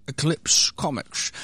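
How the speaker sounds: slow, weighty announcer read of Eclipse Comics; slow mock-grand brand name; dramatic announcer. Shown as a demonstration. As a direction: intone Eclipse Comics like a trailer voice.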